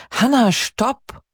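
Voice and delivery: 1 calling out. A narrator's reading. Peter calls fleeing Hannah.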